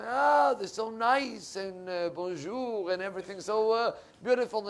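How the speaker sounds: mock gushing admiration. Imitating charmed visitors to Europe.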